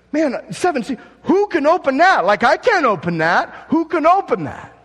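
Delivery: high, loud, wide-eyed worry; an amazed bystander at the scroll; awed onlooker. Acted. Onlookers staring at the sealed scroll, high and loud with awe.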